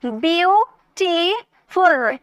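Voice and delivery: "ब्यूटीफुल" very slow, in three stretched pieces; split syllables. Shown as a demonstration. Teacher repeating for students, "ब्यूटीफुल" slowly broken in three. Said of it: "तीन syllable".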